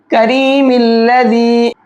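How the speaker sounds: slow chant of करीमिल्लज़ी with meem run straight into lam; joined recitation. Shown as a demonstration. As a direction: recite करीमिल्लज़ी as one word, no alif. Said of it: "मीम को लाम में मिलाएंगे".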